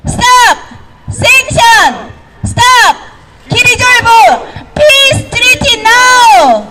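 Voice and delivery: high, drawn-out rally shouts in sequence; 구호 연달아 외치기. Real, not acted. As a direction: chant the slogans one after another, call and response. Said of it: "연결해서 하겠습니다". (높은 목소리로 연이어 구호 제창)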